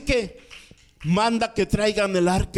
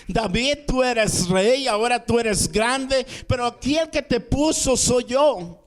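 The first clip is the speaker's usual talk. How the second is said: high-pitched, emphatic preacher's rebuke; reprensión divina; 7 semitones higher than usual. Retold or imitated. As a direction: voice God putting David in his place. Dios reprende a David. (reprensión enfática y solemne)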